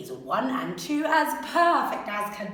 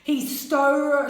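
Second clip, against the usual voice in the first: usual speaking voice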